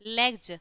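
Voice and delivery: "लेग्स" said firmly as "लेग्ज"; final j sound. Shown as a demonstration. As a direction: close "लेग्स" with a voiced j. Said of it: "ज हो गया". ("लेग्स" के आख़िर में ज बोलें)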